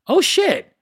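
high startled outburst; mock shock mid-meditation; sudden realisation. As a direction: blurt it like a thought breaking in. Distracted thought mid meditation.

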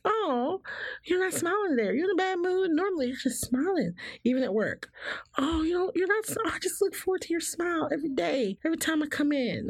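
high, sing-song coworker voice; coworkers chirping. Retold or imitated. Coworker at work missing her smile, high sing-song. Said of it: "people will be like".

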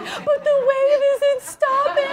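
high, slow, laughing mock wail; high drawn-out giggling complaint to her calm husband; whiny protest. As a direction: whine it like labor won't let up. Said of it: "I'm like".

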